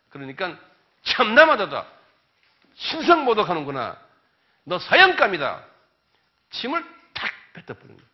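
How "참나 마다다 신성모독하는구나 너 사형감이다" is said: loud, higher outraged verdict; scornful condemnation. Retold or imitated. Preacher voicing the high priest condemning Jesus, loud scorn. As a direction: spit out the death sentence.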